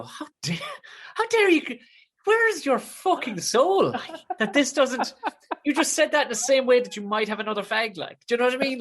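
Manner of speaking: hammed-up fury at a careless remark; mock outrage. Comedian ranting at a bored girl, mock outraged. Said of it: "I just wanted to push her out into the traffic and go".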